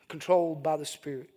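usual speaking voice

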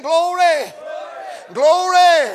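loud, ringing shouts of praise; acted crowd cheer; voice lifted. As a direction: lift the voice and cry it out. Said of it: "got their voice lifted saying".

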